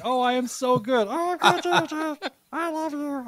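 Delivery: very high, gushing little creature voice; high squeaky penis voice. Pretend. Host voicing his talking penis answering back, very high and squeaky. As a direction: squeal it sweetly like a Furby. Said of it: "And I'd be like".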